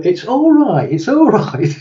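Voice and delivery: high, sing-song friendly coaxing; jolly a nervous friend along; coaxing reassurance. Acted. Friends coaxing a scared jumper.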